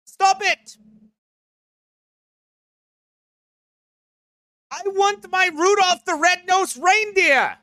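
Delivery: high-pitched, hammed-up protest; mock tantrum. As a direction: whine it high like a thwarted child. Voicing upset complainers about Christmas.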